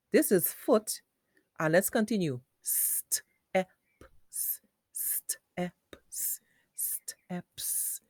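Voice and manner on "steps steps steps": "steps" in separated, soft sounds; phonics-style "steps" with hissed s; sounded out. Shown as a demonstration. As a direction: break "steps" into st, e, p, s.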